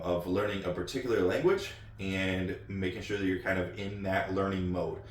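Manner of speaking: ordinary delivery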